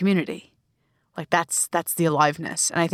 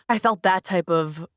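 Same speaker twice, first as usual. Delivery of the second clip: ordinary delivery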